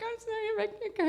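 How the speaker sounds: high, fast mock sobbing apology; retold teary confession. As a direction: blurt out an apology as if crying. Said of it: "I do the same thing".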